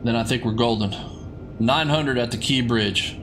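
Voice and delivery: ordinary delivery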